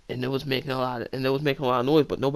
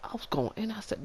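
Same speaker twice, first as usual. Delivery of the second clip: usual speaking voice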